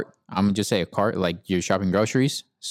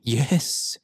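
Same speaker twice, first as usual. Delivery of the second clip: whispered yes; 3 semitones higher than usual. Retold or imitated. Annoyed reply to repeated question.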